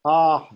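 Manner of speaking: loud "Ah" followed by a puff of breath; "Ah" with a trailing h-echo. Shown as a demonstration. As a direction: chant "Ah" and release the air after it. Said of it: "this blue is the breath following".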